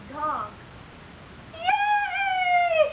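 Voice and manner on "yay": yay with real delight; excited cheer. Real, not acted. Child finds the right card.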